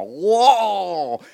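loud high thrilled whoa; excited whoa. Acted. David running up to the fallen giant, excited loud whoa. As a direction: shout it like running at your prey. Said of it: "you go".

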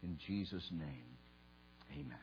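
soft, reverent public prayer. Real, not acted. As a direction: pray quietly, pausing between phrases. Leads a prayer.

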